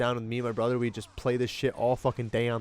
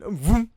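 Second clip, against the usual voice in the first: high, loud vroom; vroom sound; 8 semitones higher, 8 dB louder than usual. Retold or imitated. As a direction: make a zooming car noise. Imitating car speeding by.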